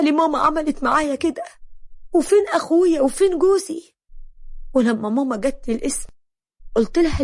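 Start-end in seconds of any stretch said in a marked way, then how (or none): none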